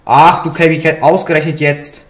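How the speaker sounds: loud exasperated cry; loud frustrated outburst. Real, not acted. Boss fight goes wrong. (laut und genervt)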